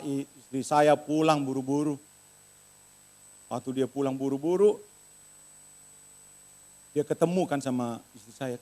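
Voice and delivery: usual speaking voice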